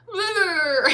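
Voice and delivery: high, whiny "Brr" into a laugh; whiny shiver. Pretend. Reader mocks herself cold from iced coffee, whiny brr. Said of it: "such a whiny baby".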